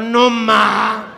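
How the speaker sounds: high, loud appalled mother; mock scandalised queen mother; shocked mother. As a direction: squeak it out like a horrified mom. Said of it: "La mamá del príncipe dijo".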